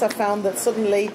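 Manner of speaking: ordinary talk, nothing marked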